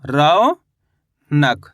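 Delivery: Raunak split in two, long rising रौ, gap, then falling नक; teacher slowly sounding out Raunak in two parts; syllable by syllable. Shown as a demonstration. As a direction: draw out रौ upward, pause, then drop on नक. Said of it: "तो मिलके बन गया".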